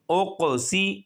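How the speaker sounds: normal talking voice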